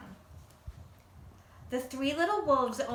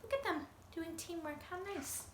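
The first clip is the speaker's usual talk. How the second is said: soft admiring aside; 7 semitones higher, 9 dB quieter than usual. Real, not acted. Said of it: "narrator aside".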